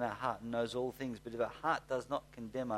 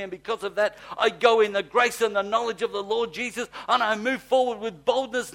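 building to a loud, high proclamation; bold prayer of faith; 6 semitones higher, 7 dB louder than usual. Shown as a demonstration. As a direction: pray it out with full boldness. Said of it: "Pray according to the word".

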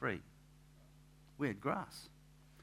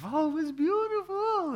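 put-on admiring the flowers in a high Maltese accent; 7 dB louder than usual. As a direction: stretch out beautiful in a Maltese lady's high voice. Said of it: "and in her Maltese accent".

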